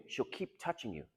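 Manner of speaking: ordinary talk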